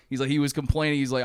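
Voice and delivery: ordinary delivery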